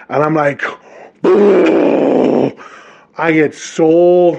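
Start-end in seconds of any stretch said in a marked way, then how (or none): 1.2-2.5 a short, far-off jeering boo; mock boo. Jeering a stranger at the rink, a quick mock boo. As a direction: boo him off like a heckler.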